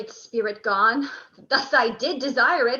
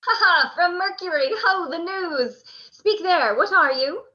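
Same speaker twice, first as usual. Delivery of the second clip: hearty hail; 5 semitones higher than usual. Acted. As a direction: boom out a welcome. Antony hailing the messenger.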